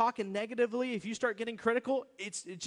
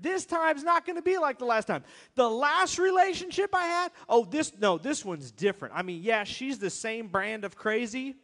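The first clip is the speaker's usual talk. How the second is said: self-deluding promise; 5 dB louder than usual. Retold or imitated. A churchgoer fooling himself, insistent. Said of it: "and then we say this".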